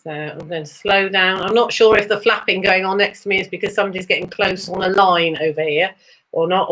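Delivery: ordinary delivery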